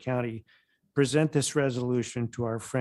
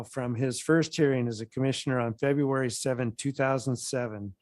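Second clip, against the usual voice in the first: ordinary delivery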